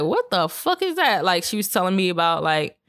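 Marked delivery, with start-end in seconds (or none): 0.0-1.2 high, startled "what the fuck"; shocked reaction. Retold or imitated. Seeing a tarot reading, reacting in shocked disbelief. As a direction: blurt it out in disbelief. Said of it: "and I was like".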